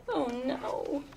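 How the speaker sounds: usual speaking voice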